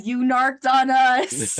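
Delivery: loud, drawn-out mocking imitation; mock monster accusation. Player mimics the creatures who came to attack, mock accusing shout. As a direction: stretch it out in mock outrage. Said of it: "They, they really came and said".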